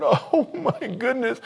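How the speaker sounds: high, slow laughing gasp of wonder; amazed laugh. Retold or imitated. Pastor reliving playing the first guitar, laughing in awe. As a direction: marvel at it through a laugh.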